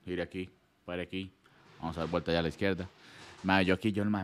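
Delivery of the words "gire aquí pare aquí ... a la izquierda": low, soft driving instructions; hushed, quiet retelling. Retold or imitated. Friend retelling his quiet driving test, hushed low voice. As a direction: give terse directions in a subdued voice. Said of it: "todo callado aquí".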